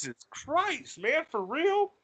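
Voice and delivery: high, drawn-out incredulous exclamation; retold disbelief. Retelling his shocked reply.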